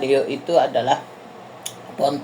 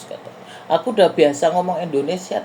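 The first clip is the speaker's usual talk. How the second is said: usual speaking voice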